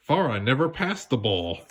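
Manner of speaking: ordinary delivery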